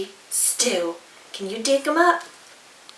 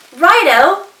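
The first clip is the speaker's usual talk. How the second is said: higher, louder cheerful agreement; eager dingo; 7 semitones higher, 8 dB louder than usual. Acted. Dingo happily adding feathers, high and loud. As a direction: toss them in gleefully.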